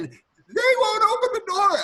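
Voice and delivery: high, loud, theatrical wail; frantic cry. Acted. Butler re-enacting the locked door scene, frantic. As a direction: cry it out in mounting panic.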